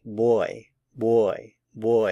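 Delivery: put-on "boy, boy, boy" with a hard English B. Shown as a demonstration. Teacher shows the initial V said like an English B. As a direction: start "boy" with a firm B sound, lips closed. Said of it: "sounds a lot like the English word boy".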